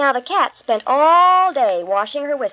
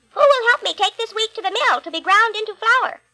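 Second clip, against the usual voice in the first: high eager hen voice; little red hen; 10 semitones higher, 4 dB louder than usual. Acted. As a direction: ask brightly as the little hen. Hen calls out.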